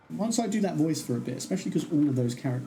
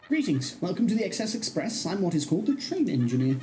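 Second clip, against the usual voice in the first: higher, swooping welcome-aboard voice; proud train engineer; 6 semitones higher than usual. Acted. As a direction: announce the luxury trip with ceremony, pitched up.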